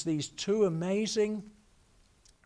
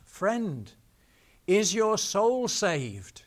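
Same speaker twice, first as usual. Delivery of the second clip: slow, louder call across the water; 5 semitones higher, 6 dB louder, much slower than usual. Retold or imitated. Harper calling to a drowning man, slow and raised. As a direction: call slowly over dark water. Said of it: "He heard a voice calling out".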